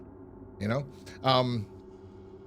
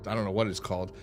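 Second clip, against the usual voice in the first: ordinary talk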